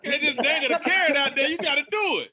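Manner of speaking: high, loud, excited egging-on; loud playful urging. Real, not acted. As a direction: call out loudly, demanding a demonstration. Friends loudly egg speaker to demonstrate.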